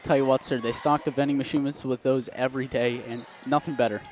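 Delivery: usual speaking voice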